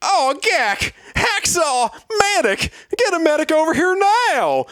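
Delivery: very high, hammed-up scream; scream for help. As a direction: scream desperately for a medic in a put-on high voice. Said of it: "came a scream from outside".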